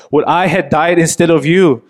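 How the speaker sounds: anguished cry. Acted. David mourning his dead son, anguished cry. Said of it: "he cried out".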